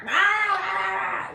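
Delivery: high shouted rah rah, like a cornered animal; imitated animal fighting cries. Imitating Sid's frantic cries during her medication. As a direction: bark out the bird's frantic cries, high and loud. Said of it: "that's the way she sounds".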